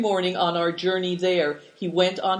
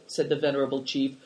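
ordinary delivery

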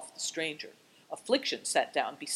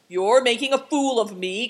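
loud, high protest; raised voice, offended outburst; indignant cry; 6 semitones higher, 9 dB louder than usual. A narrator's reading. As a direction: cry out, affronted. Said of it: "cried the man".

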